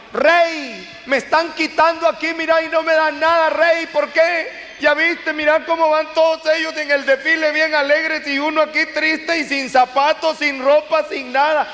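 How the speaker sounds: high, pleading beggar's cry to the king; poor man on the corner calling to the king; beggar shouting at the king. Retold or imitated. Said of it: "Lo apartaron".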